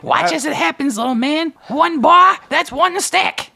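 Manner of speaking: very high, squeaky hustler voice. Acted. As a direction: play a slick, grinning money changer. Money changer shows gold trick.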